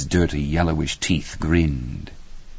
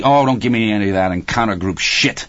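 irritated retort, pitched a bit higher; angry; 4 semitones higher, 3 dB louder than usual. A narrator's reading. Husband rejects his wife's lecture, moderately angry. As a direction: brush it off with acted anger. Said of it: "Hal said angrily".

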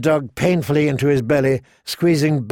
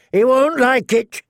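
higher, warning townsman; mild threat; 9 semitones higher, 3 dB louder than usual. Acted. A townsman warns the outlaws, higher and threatening. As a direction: warn them of the Dutchman. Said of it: "a man threatened".

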